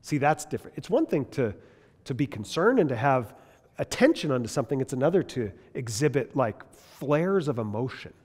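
ordinary talk, nothing marked

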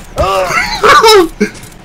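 high burst of laughter; loud shrieking laugh. Real, not acted. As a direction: cackle out loud at the blunder. Laughing after teammate's mistake.

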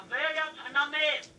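"Vietnamese" drawn out very slowly, syllable by syllable; slow pronunciation demo. Shown as a demonstration. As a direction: teach "Vietnamese" slowly for him to repeat. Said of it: "say it with me".

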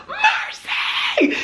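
breathless high shriek, half laughing. Retold or imitated. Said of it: "I was like".

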